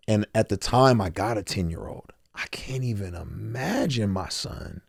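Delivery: whispering, holding back tears; real choked-up whisper about his son. Real, not acted. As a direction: fight the tears and drop to a whisper. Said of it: "ah, I don't wanna cry on this".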